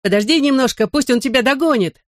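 high-pitched eager call. A narrator's reading. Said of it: "крикнул ему я".

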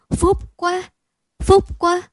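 high, loud cry of joy; mother blesses her luck aloud; overjoyed cry. A narrator's reading. Mother overjoyed at son's return, high joyful cry.

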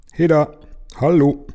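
high, loud hail from afar; calling out. A narrator's reading. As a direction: call out to see who's there. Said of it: "rief plötzlich eine Stimme".

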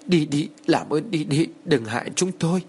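high, pleading murmur; fearful muttered begging. A narrator's reading. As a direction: beg a ghost to leave. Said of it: "miệng liên tục lẩm bẩm".

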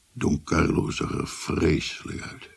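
normal talking voice

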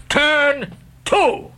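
high, drawn-out bellow; captain roaring. Acted. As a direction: roar the order. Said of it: "roared the captain".

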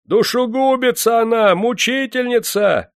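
very high, loud accusing cries; crowd shouting. A narrator's reading. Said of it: "снова закричали в толпе". (обвиняющие крики из толпы)

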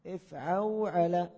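افعوعل drawn out very slowly syllable by syllable; slow model pattern. Shown as a demonstration. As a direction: stretch افعوعل so each letter is heard. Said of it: "teacher models the pattern". (نطق افعوعل ببطء مقطعا مقطعا)

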